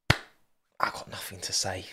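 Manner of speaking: hushed, breathy whisper that drops the voice away; slow, unvoiced mock whisper. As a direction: whisper it like a guilty secret. Whispered, then repeated plainly.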